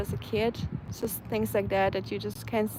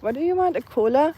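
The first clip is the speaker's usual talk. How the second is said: imitating a baffled waiter, pitched up; higher, louder mock disbelief; weirded-out reaction; 5 semitones higher, 5 dB louder than usual. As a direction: question it like you've never heard of it. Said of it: "looked at me in a very weird way".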